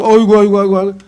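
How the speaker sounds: loud theatrical wailing aigo; mock wailing aigo. Weeping believer at the meeting, hammed-up loud wailing. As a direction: wail aigo like a mourner, hammed up. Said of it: "눈물 콧물 흘리면서".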